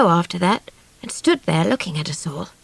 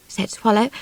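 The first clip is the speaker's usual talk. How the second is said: plain reading voice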